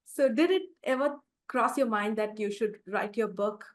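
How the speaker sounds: ordinary talk, nothing marked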